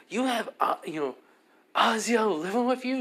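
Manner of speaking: high, squeaky outraged friend voice; jealous classmate. Retold or imitated. Jealous classmate questions Issei.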